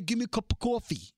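mock New York accent. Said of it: "none of us say".

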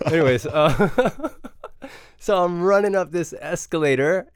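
can't stop laughing at himself. Real, not acted. Laughing at his own slip.

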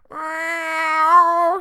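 high loud meow; high cat cry. A narrator's reading. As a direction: mew it like a cat. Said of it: "said Delphinia".

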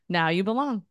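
ordinary talk, nothing marked

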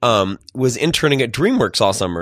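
ordinary talk, nothing marked

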